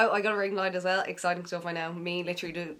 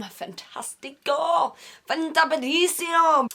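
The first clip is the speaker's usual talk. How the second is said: high, slow showy Italian-ish voice; mock Italian flourish; 8 semitones higher, 4 dB louder, slower than usual. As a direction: ham it up like a chef. Playful mock Italian words.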